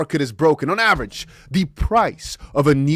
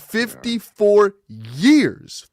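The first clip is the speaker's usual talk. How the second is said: higher, louder, stunned disbelief on WHAT; incredulous outburst; 6 semitones higher, 5 dB louder than usual. Real, not acted. Host baffled by housing prices, asking loudly in disbelief. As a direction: demand to know how this could happen.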